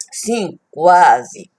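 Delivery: usual speaking voice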